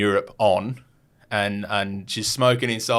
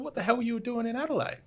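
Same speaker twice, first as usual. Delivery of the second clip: high, softer squeal of disbelief; mock incredulous; 13 semitones higher, 8 dB quieter, faster than usual. Storyteller recalls questioning the lady about Adelaide, mock incredulous. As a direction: ask it in a high, amazed squeak.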